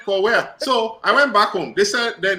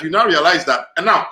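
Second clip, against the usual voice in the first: ordinary talk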